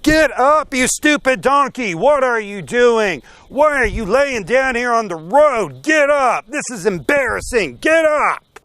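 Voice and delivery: loud, high-pitched acted tirade; mad, furious shouting. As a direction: yell at a stubborn donkey to move. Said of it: "Balaam is getting mad at this donkey".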